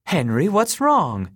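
high, slow alarmed question; sudden worry for his friend. A narrator's reading. Kenny seeing Maroney convulse, alarmed.

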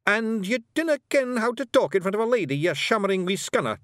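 high, loud Scots telling-off; scolding Feegle. A narrator's reading. Rob scolds brother.

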